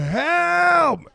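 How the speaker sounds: high, long cry of help, falling away; one desperate drawn-out Help; shouted call for help. Retold or imitated. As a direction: call out Help from far out in the water. Calling for help from the surf.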